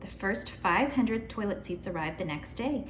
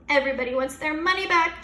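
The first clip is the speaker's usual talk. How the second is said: shouting; 6 semitones higher, 5 dB louder than usual. A narrator's reading. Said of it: "shouted Mister Cooper".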